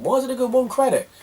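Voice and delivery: high, baffled question voice; sudden high puzzled inner voice; puzzled thought. Retold or imitated.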